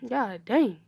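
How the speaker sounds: ordinary delivery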